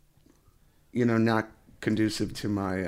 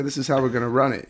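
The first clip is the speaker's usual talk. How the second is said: fast, louder coach commands; 4 semitones higher, 6 dB louder, faster than usual. Retold or imitated. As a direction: bark it out like a sports coach. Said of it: "Just put on your coach's voice".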